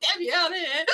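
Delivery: high shrieking laugh through the words; laughing hard. Real, not acted. Retelling David's reaction, she's choking with laughter. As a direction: gasp the words out between laughs. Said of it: "you're making me choke".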